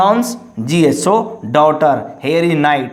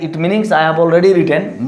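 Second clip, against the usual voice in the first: usual speaking voice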